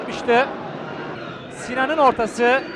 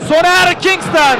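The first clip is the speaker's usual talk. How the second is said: excited commentator call; 5 semitones higher, 6 dB louder, slower than usual. Real, not acted. Dangerous attack, keeper called.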